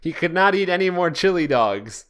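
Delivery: ordinary delivery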